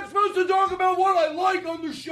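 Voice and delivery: very high-pitched protest; mock outraged shriek. Host defending his plug to co-host, mock outrage. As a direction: squawk it in hammed-up exasperation.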